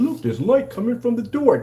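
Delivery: high-pitched mock amazed demonstrator; put-on high voice, poking fun; mocking imitation. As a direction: mimic a clueless presenter in a high voice. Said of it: "people opening and go".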